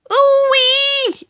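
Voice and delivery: shrieking yelp. Acted. Grandma after swallowing the medicine: high shocked shriek. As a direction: let out a sudden shocked whoop. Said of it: "Grandma yelled".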